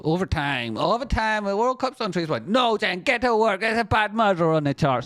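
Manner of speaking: high-pitched frantic summons to work; frantic call-out. Acted. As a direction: call her urgently to duty, voice climbing high. Imagined frantic call to Jen.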